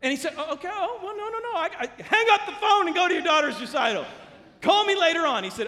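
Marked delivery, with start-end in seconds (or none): 0.4-1.6 high, laughing mock fluster; hammed-up, overeager stammer; flustered protest. Imitates flustered man protesting. 1.6-5.4 loud, high cut-in; retold order at full volume; exasperated shout.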